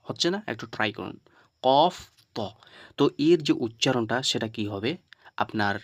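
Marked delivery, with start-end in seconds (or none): 1.6-2.4 "কফ", then "ত", each loud, full and round; thick, heavy letters. Shown as a demonstration. Teacher asks learners to try, "কফ ত" loud and heavy. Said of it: "আওয়াজটা একটু মোটা হয়".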